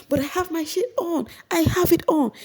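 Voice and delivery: very high shrieking protest; mock her high defiant insistence; imitating an upset housemate; defiant Mercy. Mercy arguing with Big Brother.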